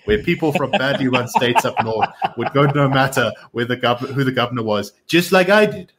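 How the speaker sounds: amused political impression, lifting on the boast; Donald Trump. Reading Trump's brag to a laughing co-host, mock-Trump voice. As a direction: put on a Trump accent and punch the brag. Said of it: "do it in my Trump accent".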